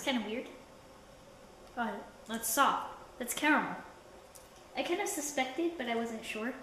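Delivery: talking while chewing candy; sticky chewy food in mouth; mouth full, chewing. Real, not acted. Seen 1: puts candy piece in mouth, jaw working, chewing faces around 4s.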